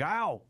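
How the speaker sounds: sharp yelp of pain; ow like you just got hit. Shown as a demonstration. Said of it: "as in ow when you cop a".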